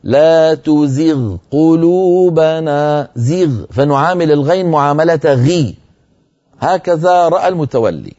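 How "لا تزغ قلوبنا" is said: تزغ drawn out slowly, the غ given the heavy weight of غي; slow demo recitation. Shown as a demonstration. Teacher recites for students per al-Mutawalli's view. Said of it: "اسمعوني جيدا".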